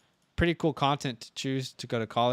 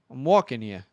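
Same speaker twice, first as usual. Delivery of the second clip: put-on New York accent; 2 semitones higher, 7 dB louder than usual. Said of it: "you boys have thick New York accents".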